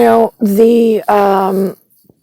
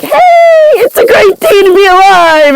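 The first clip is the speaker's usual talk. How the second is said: high, loud, beaming shout of a sunny dad; cheery dad impression; 13 semitones higher, 5 dB louder than usual. Mocking her dad's sunny greeting with a high loud cheery shout. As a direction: belt it out like a relentlessly upbeat father. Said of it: "My dad's like".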